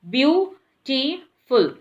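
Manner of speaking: beautiful said slowly, syllable by syllable: beau-ti-ful; split into syllables. Shown as a demonstration. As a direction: stretch beautiful out into three clear parts. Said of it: "we are making it in three parts".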